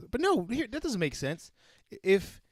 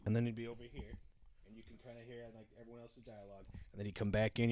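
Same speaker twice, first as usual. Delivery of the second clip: fading far softer and lower; demo of fading audio; 5 semitones lower, 12 dB quieter, faster than usual. Shown as a demonstration. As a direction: drop away like muffled dialogue. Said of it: "super loud like this".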